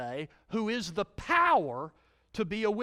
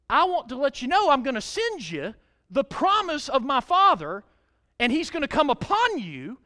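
loud, higher preaching voice; fiery preaching; 5 semitones higher, 8 dB louder than usual. Acted. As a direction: proclaim it to the crowd. Preaching the scripture at full force.

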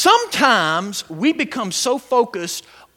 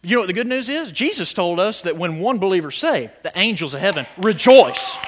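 usual speaking voice

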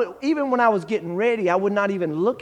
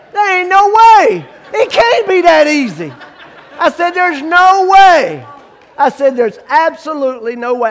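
much higher, much louder, laughing shout; ecstatic disbelief; 9 semitones higher, 10 dB louder than usual. Retold or imitated. New believer shouting that salvation can't be this easy, ecstatic disbelief. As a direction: shout it out, too good to be true.

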